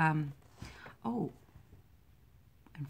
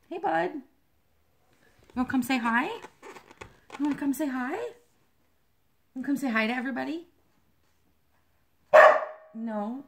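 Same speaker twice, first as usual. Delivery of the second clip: high, sing-song voice for a dog; pet talk; 8 semitones higher, 7 dB louder than usual. Real, not acted. Inviting the dog to greet viewers, high baby talk.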